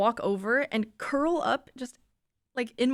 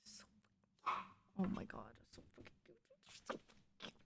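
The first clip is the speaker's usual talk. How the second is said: hushed unvoiced gushing; whisper; 2 semitones lower, 21 dB quieter, much faster than usual. Real, not acted. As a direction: whisper so the dog stays asleep. Gushing quietly over sleeping dog.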